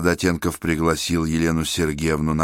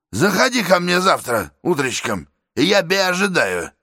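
a boor showing off; brash working man; 11 semitones higher than usual. Acted. Man in cap, brash decision.

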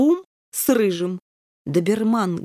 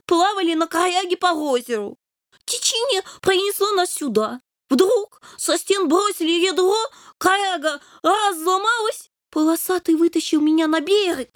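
much higher kitten, mildly sad; sorrowful kitten; 8 semitones higher than usual. A narrator's reading. Kitten sadly tells the king how he lost his friend. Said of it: "горестно рассказал Рыжий".

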